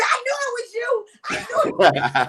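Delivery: squealing fan, high then loud; excited recognition. Retold or imitated. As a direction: gush like a starstruck fan.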